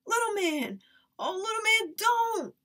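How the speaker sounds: high, loud panicked cry; shouting. Acted. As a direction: scream a warning at him. Sister yells warning.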